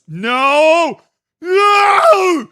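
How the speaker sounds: loud high yells of no; shouting no. Real, not acted. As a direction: yell no in high alarm. Shocked reaction yelling no.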